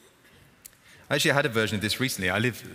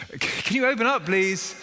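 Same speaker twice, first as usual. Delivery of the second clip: higher, louder pleading call through the door; urgent plea; 11 semitones higher, 4 dB louder, slower than usual. Acted. Villager knocking again at midnight, high urgent begging. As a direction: beg again, more desperate.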